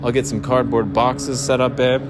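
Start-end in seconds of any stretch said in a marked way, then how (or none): none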